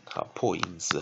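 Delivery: ordinary talk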